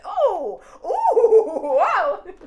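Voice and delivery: loud high laughing exclamations; excited. Real, not acted. Getting excited about her own words on the podcast, loud high exclamations. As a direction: burst out thrilled and giggling. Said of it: "I get excited about my own things".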